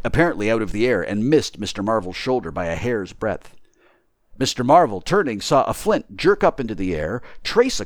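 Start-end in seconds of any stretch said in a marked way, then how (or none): none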